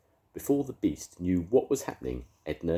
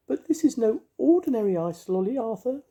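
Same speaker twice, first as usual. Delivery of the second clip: very high, louder fairy voice; comforting fairy; 8 dB louder than usual. A narrator's reading. Mavis comforting the ice lolly in a high fairy voice. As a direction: soothe him in a tiny high fairy voice.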